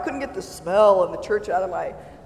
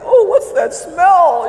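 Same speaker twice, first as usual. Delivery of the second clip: disgusted; 9 semitones higher, 12 dB louder, slower than usual. Retold or imitated. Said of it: "you're like".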